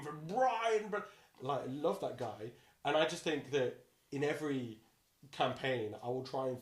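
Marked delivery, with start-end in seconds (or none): 0.1-1.0 big, higher, drawn-out boom; larger-than-life actor's bellow; Brian Blessed. Acted. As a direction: relish the name at full size. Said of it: "I just love saying his name".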